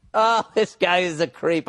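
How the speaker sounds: oh, this guy is a creep, slow and disgusted. Acted. Host repeating his verdict on the writer, emphatic.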